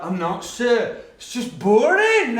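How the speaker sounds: loud, high, drawn-out protest; hammed-up schoolboy whine; whining pupil. Pupil protesting to his teacher mid-lesson, hammed-up whine. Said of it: "But it came out like".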